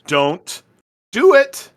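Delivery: final word shot up high and loud; stern warning. Acted. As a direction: bark the last word as a threat. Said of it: "she says".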